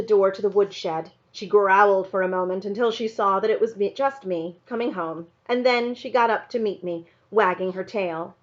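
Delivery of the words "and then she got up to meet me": ordinary delivery, nothing marked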